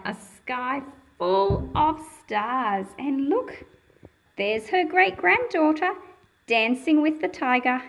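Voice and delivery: usual speaking voice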